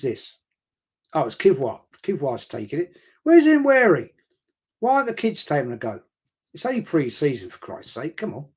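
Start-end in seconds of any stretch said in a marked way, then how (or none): 3.2-6.0 much higher and louder, disbelieving; exasperated outburst. Real, not acted. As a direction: call it out in frustration. Annoyed at penalty taker choice.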